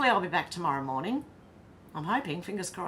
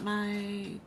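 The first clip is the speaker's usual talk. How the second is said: normal talking voice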